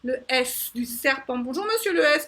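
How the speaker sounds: ordinary talk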